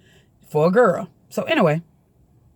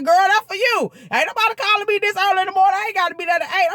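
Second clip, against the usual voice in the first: much higher, mocking sister; sister's put-on voice; 11 semitones higher, faster than usual. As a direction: whine like the annoyed sister. Sister annoyed about phone.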